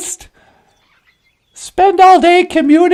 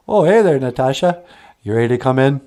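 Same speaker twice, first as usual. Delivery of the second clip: much lower, odd voiced character; low puppet voice; 3 dB quieter, faster than usual. Puppet answering Natasha, deep voiced character.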